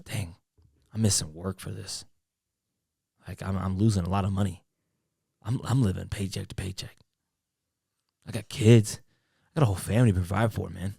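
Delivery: low, subdued tale of hardship; put-on hard-luck plea. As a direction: plead your case quietly, laying on the hard luck. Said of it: "that's my go-to saying".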